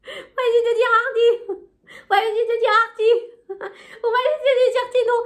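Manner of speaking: high comic voice from a sketch, laughing; sketch quote. Retold or imitated. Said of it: "ça rappelle le sketch des Inconnus". (imitation du sketch des Inconnus en riant)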